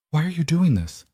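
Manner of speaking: ordinary delivery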